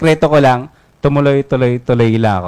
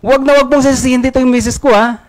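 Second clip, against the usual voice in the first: higher, louder indignant defense; angry retort; 9 semitones higher, 4 dB louder than usual. Acted. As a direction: snap back in anger at your coworker. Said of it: "Galit yung si Juan". (galit na sagot, mas mataas at malakas)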